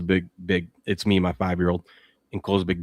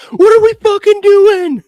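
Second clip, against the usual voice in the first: put-on high, loud, slow little-kid shout; a small boy parroting the grown-up's yell; kid copying a yell; 15 dB louder, slower than usual. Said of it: "Ace stands up and goes".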